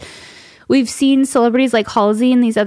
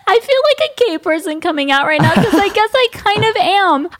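nervous laughter; 8 semitones higher than usual. Real, not acted. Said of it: "I don't know why I'm so nervous".

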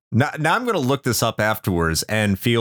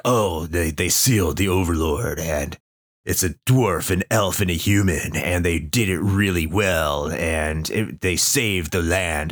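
lower, hammed-up epic prologue voice; mock dramatic narrator; 6 semitones lower than usual. Mocking the game's intro for the co-hosts, hammed-up lower epic narration. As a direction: parody a grand fantasy intro. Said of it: "they try to be really dramatic".

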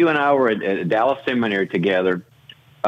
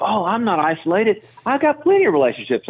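mock defensive protest, much higher and quicker; defensive leader; 10 semitones higher than usual. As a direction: play the leader brushing it off, pitched way up. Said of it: "and they'll go".

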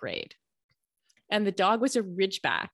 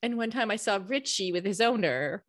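ordinary talk

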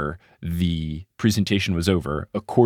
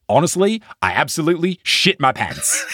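higher-pitched, lightly attempted Kiwi accent; Steven Adams; 8 semitones higher than usual. Podcaster quoting player's confession, high light New Zealand accent. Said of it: "Steven Adams just says".

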